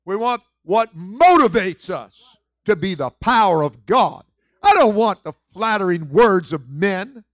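loud, high, fired-up preacher; loud fired-up sermon; full-force preaching. Acted. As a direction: preach it hard to the congregation.

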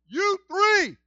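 booming commanding call; God's voice. Acted. Preacher voicing God calling three. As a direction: summon them like an angry God.

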